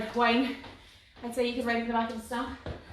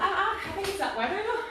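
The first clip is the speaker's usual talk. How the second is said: laughing; 7 semitones higher than usual. Real, not acted. Tired, laughing excuse.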